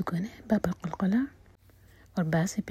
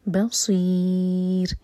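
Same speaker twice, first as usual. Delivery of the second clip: voiced, very slow tajweed recitation; slow Quran recitation; much slower than usual. Shown as a demonstration. Teacher recites the verse to show the rules, slowly. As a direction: draw each syllable out carefully. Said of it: "and میں را ساکن light".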